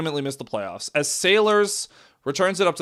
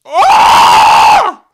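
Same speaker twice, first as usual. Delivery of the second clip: very loud high scream; frustrated scream; 17 dB louder than usual. Real, not acted. Fan screaming at the game, full scream.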